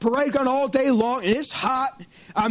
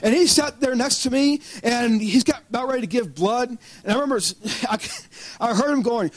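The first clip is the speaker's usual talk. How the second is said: usual speaking voice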